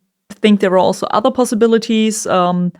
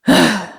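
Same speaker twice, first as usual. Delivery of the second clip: annoyed sigh. Shown as a demonstration. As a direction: sigh like you're really annoyed. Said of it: "trying to sigh".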